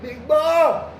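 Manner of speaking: high, loud holler across the yard; calling out. Retold or imitated. Inmate hollering a name across the prison yard, loud high call.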